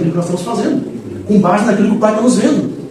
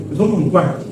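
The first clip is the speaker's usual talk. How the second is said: ordinary talk, nothing marked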